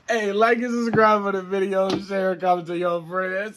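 loud, high YouTuber sign-off; vlog outro. Acted. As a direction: pump up the outro call.

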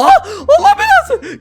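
real shock as the thief reappears in the game; startled outburst. Real, not acted. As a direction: yelp it as the thief strikes. Thief steals again, startled outburst.

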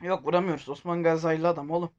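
usual speaking voice

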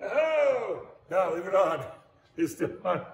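sudden high alarmed cry, then urging; a real startled yelp of dismay; alarmed surprise. Real, not acted. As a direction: caught off guard as the machine dies. Machine cut suddenly stops.

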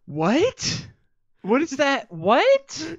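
high, loud incredulous outburst; baffled disbelief. Real, not acted. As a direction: react in utter bafflement, voice leaping up. Listener's incredulous reaction to quote.

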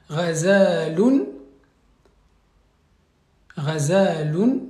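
Ghazalun spelled out slowly with a guttural gh; slow modelled Arabic word. Shown as a demonstration. Teacher repeats Ghazalun slowly for the class. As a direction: say Ghazalun slowly, stretching each syllable. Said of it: "très bien".

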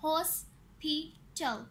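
"Hospital" slowly in three beats; "hospital" broken into three syllables. Shown as a demonstration. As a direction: say "hospital" as हॉस, पि, टल with pauses. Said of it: "three syllables".

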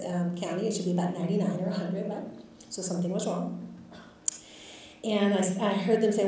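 ordinary talk, nothing marked

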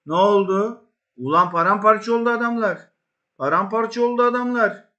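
high, loud mocking gloat; triumphant taunting. Real, not acted. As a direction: rub it in over the rival. Gloating over beaten Inter.

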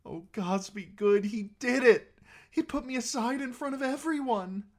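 very high, loud, thrilled squeal; squeal of joy. A narrator's reading. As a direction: squeal with overjoyed relief. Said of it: "to muffle a squeal of joy".

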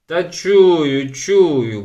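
loud, annoyed grumble; grumbling. A narrator's reading. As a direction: brush off the nagging. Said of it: "бурчав Кнут". (бурчливо)

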